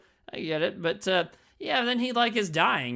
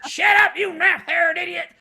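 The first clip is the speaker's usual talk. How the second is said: much higher, louder mock yell at a brother; furious yell; 11 semitones higher, 9 dB louder than usual. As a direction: bark it like a fed-up cook. The cook yelling at Chop Top.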